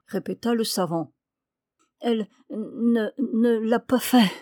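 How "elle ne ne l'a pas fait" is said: halting, strained wounded man; faint, faltering voice of the injured; weak murmur. A narrator's reading. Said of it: "murmura le blessé d'une voix faible".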